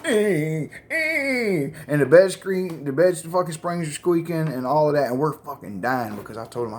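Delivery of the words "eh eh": repeated drawn-out "eh" moans; mock someone grunting during sex; imitated grunt. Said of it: "like that dude on Forrest Gump".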